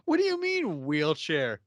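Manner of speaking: shocked. Retold or imitated. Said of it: "He's like".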